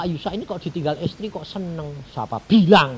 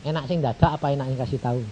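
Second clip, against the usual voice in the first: normal talking voice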